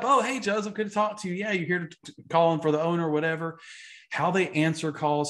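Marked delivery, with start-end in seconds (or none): 0.0-3.1 mock bright receptionist pitched way higher; perked-up cheerful greeting. Receptionist recognizing the caller, suddenly bright and cheery. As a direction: perk up and sound delighted to hear from him. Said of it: "They cheer up".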